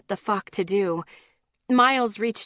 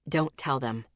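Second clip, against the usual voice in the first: man's voice; 7 semitones lower, 4 dB quieter than usual. A narrator's reading. Miles voiced.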